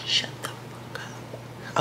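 harsh whisper under the breath. Real, not acted. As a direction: hiss it quietly, annoyed. Whispered retort to doubters.

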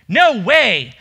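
loud pig refusal; crying out. Acted. Three pigs refusing the wolf, loud cry. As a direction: shout it together defiantly. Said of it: "cried the three little pigs".

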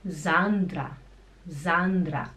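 "Sandra" starting with a Z sound; German pronunciation. Shown as a demonstration. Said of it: "E a Sandra seria".

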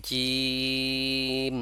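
জিম held long for three alifs, lips wide, not rounded; Arabic letter jeem drawn out. Shown as a demonstration. Teacher showing students the letter, জিম drawn out long. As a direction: stretch জিম slowly with the tongue middle touching the palate. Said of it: "যেমন বলা হচ্ছে".

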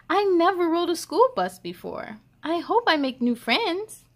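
put-on bright, lively read-aloud for kids; cheerful storybook reading; story narration. A narrator's reading. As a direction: read it like a picture book to little ones. Reads picture book aloud.

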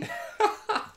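high airy burst of laughter; breathy laugh. Real, not acted. Laughing after teasing his co-host, a high airy laugh. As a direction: laugh at your own tease.

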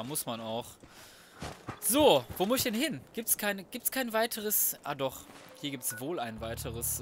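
ordinary delivery

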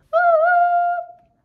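high, loud whistling woo; train whistle woo. Shown as a demonstration. Teacher hooting like a train whistle for kids. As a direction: hoot like a steam train.

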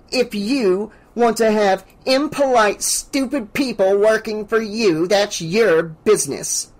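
high, slow, huffy parting shot; haughty indignation. A narrator's reading. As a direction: leave in a huff, scornfully. Offended customer's haughty parting shot.

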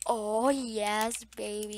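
ordinary talk, nothing marked

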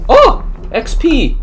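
high, loud burst of surprise; startled exclamation. Real, not acted. Gamer spots XP in the game, startled exclamation. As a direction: exclaim sharply, pitch leaping up.

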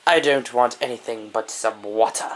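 low, quiet British dog voice; British accent. Pretend. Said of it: "Why do you have a British accent".

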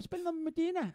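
lightly imitated little girl, high-pitched; mock girl's shout. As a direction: pitch it up like a small girl calling, without volume. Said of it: "der råbte".

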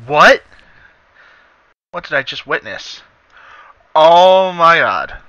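loud shocked cry, drawn out; stunned disbelief. Real, not acted. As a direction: react like you can't believe it. Stunned by sudden win.

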